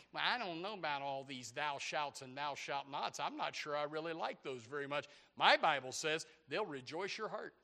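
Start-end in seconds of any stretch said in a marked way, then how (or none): none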